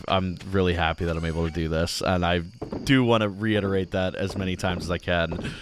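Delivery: usual speaking voice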